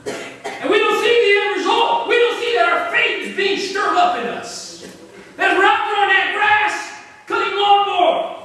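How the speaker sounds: loud, high, fervent preaching; loud pulpit call for an amen; preaching at full force. Acted. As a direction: drive the lesson home to the congregation. Preacher applies the lesson.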